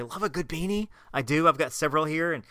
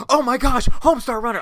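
louder, higher thrilled shout; excited fan; 8 semitones higher, 6 dB louder than usual. Retold or imitated.

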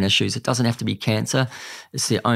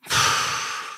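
heavy sigh. Real, not acted. Emotional pause after recounting.

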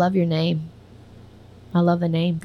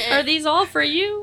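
laughing mock question; 9 semitones higher than usual. Mock drive-thru worker, laughing.

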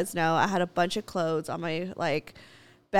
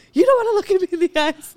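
fast, higher and louder parody of him; mock boyfriend whine; 9 semitones higher, 6 dB louder, faster than usual. Imitating her boyfriend's accusation, fast high mock whine. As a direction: exaggerate his hurt complaint.

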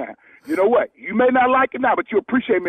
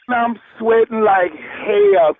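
ordinary delivery

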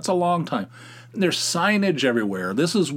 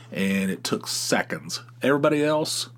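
usual speaking voice

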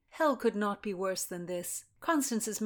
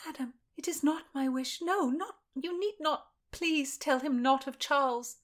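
higher voice, begging for mercy; a young lady in service, desperate and pleading; frightened young lady pleading; 7 semitones higher than usual. Acted. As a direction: plead higher, breathless with fear. Constance voiced again.

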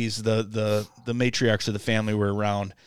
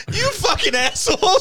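very high, loud hammed-up outrage; aunt cursing the buddy in mock high-pitched fury; mock furious aunt; 6 dB louder than usual. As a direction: shriek the insult as the aunt. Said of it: "And she said".

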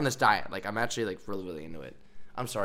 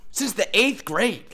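much higher and louder, pleading; indignant protest; 8 semitones higher, 4 dB louder than usual. Retold or imitated. Player protesting to the coach, high indignant plea. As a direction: protest like it's absurd.

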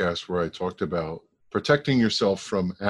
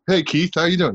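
higher, louder retold greeting; mock friendly hail; 9 semitones higher, 6 dB louder than usual. Acquaintances hailing him by his brother's name, mock friendly hail. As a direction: call it out like a hearty hello, imitating. Acted greeting from people at EAS.

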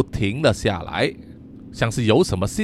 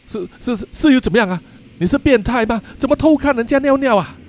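stammering, then higher and defensive; flustered; 9 semitones higher, faster than usual. Acted. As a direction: caught out and blustering back, climbing high. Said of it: "不知所措地说". (不知所措、越说越高的慌张)

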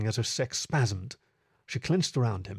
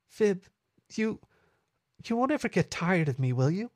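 higher, halting, tentative character voice; vulnerable; 8 semitones higher than usual. A narrator's reading. Meadow asks her lover for reassurance, vulnerable. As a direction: hesitate between words, pleading for reassurance. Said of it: "asked with a vulnerable whisper".

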